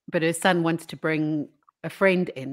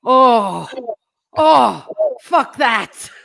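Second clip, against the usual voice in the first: loud, high shocked yell breaking into a laugh; shocked Nan outburst; 7 semitones higher, 11 dB louder, much slower than usual. Retold or imitated. Nan sees the huge nose, loud shocked yell. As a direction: blurt it out, shocked and horrified. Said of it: "And she's like".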